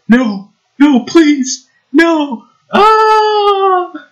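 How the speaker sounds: very high, pleading hammed-up scream; mock horror scream. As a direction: play the doomed horror victim. Horror movie victim acted.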